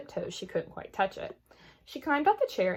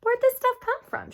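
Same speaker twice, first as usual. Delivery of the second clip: put-on high, curious child's question; little sister asking her sister, pitched high; curious little girl; 5 dB louder than usual. A narrator's reading. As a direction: ask wide-eyed.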